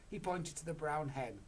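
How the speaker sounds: plain reading voice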